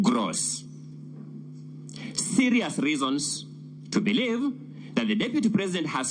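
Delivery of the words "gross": gross set apart as a weighty word; emphatic, formal stress on gross. Real, not acted. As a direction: give gross its own deliberate weight. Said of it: "We emphasize the drafter's use".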